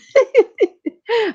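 bursts out laughing loud and high; a big high cackle of delight; helpless loud laughter. Real, not acted. Laughing mid story.

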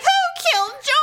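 mock the busybodies demanding justice; mock outraged neighbor. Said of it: "there are fools out there who will be like".

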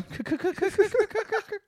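high, squeaky mock cackle; imitated fake laugh. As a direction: parody a forced dry laugh. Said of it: "I don't like your fake laugh".

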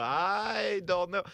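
slow, high, bewildered drawl; baffled. Retold or imitated. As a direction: stretch it out, totally lost. Host's stunned reply.

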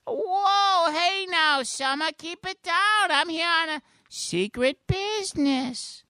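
very high cartoon voice; high, drawn-out silly voice; Mister Poopy Butthole. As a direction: squeal it slowly like a cheery cartoon creature. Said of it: "Mister Poopy Butthole".

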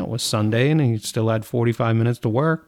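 usual speaking voice

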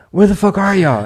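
loud, exasperated yell; frustrated shout. Retold or imitated. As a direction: call out angrily. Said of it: "I'm like".

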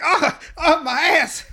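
high, loud yelps of pain; mock pained yell. Boy hit by his own fireball, mock pained yell. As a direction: cry out comically, half laughing. Acting out being burned by fireball.

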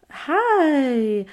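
high, drawn-out awkward hi; caught-out greeting. Retold or imitated. Girl caught kissing by her bishop, awkward high greeting. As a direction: imitate an over-innocent, high-pitched greeting.

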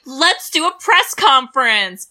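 loud, high, drawn-out mock pep; mock enthusiasm. Mimicking the company pushing a press conference on a survivor, mock enthusiasm. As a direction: pitch it like an oblivious company booster.

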